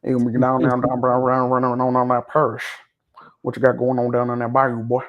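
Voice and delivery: a hint of a Louisiana friend teasing; Louisiana accent imitation. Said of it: "You ever hear Timbo talk?".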